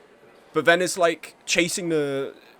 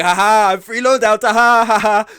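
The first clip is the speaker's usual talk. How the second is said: loud, higher mock laughing taunt; 5 semitones higher, 11 dB louder than usual. Mocking an enemy player's gloat, loud and higher. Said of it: "to be like".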